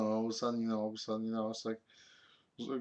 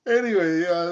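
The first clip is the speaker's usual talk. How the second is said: ordinary delivery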